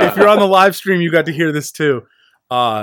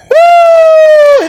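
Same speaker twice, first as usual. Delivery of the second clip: big shouted woo; loud whoop; 11 dB louder than usual. Acted. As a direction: whoop it out at full volume. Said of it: "I need to get my yell on".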